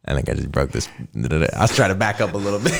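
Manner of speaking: ordinary delivery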